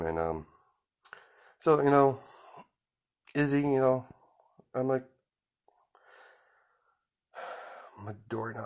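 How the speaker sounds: ordinary delivery, nothing marked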